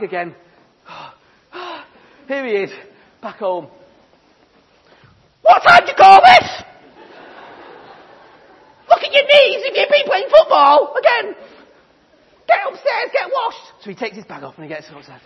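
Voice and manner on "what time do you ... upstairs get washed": much higher, loud shrieking mum; scolding mum. Acted. Mum yelling at Jimmy coming home late, shrill and loud. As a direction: tear into him like a furious mother.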